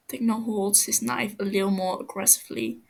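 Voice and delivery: ordinary delivery, nothing marked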